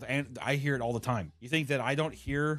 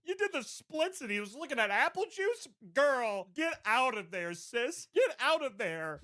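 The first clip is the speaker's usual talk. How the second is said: put-on high, slow sassy girlfriend voice; sassy commenter; 10 semitones higher, slower than usual. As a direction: tell her to leave, all sass. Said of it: "viral posts had thousands of comments".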